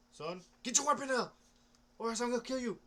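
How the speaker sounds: loud mock-threatening cop voice; mock cop threat. Cop orders a man in the desert, loud mock threat.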